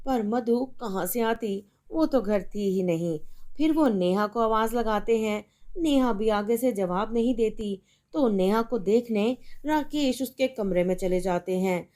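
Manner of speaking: usual speaking voice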